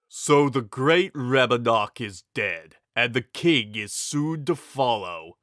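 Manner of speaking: lightly voiced big villain gloating; menacing brute. A narrator's reading. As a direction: savor the king's defeat. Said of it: "the big man said".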